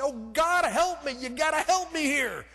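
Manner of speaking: a desperate prayer shouted aloud, pitched high; loud desperate plea. Retold or imitated. Stranded traveller begging God aloud for help, in a loud, high cry. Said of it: "I just out loud said".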